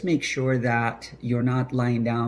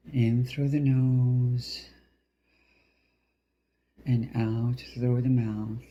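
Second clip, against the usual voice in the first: slow meditation voice with drawn-out final words; guided breathing; slower than usual. Acted. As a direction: pace each phrase slowly with the breath.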